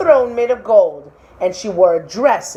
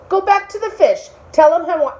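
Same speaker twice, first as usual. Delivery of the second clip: high, bossy wife voice; demanding wife; 9 semitones higher, 4 dB louder than usual. Acted. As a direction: order him off to the sea. Wife demands more from husband.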